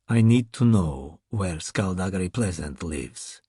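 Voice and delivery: a big masked Russian, calm and menacing; Russian accent. Acted. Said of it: "the big man said with an accent".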